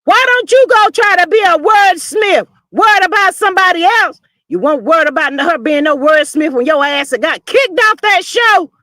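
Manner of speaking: loud, high, indignant yelling; angry shout. Real, not acted. Host yelling at the show guest, furious shout. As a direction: blast her for the hypocrisy.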